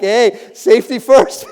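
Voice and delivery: drawn-out, laughing neighbor's call; slow teasing greeting through a laugh; mock passing neighbor. Neighbors driving past tease the man mowing in a helmet, slow and laughing.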